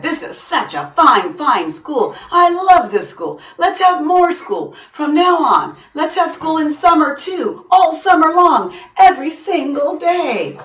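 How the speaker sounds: high, loud, gushing principal voice; a proud principal carried away with delight; excited principal. A narrator's reading. Mister King's proud speech.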